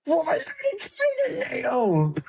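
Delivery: very high, strained Norfolk caller; Norfolk accent. Pretend. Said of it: "can't do a strong enough Norfolk accent".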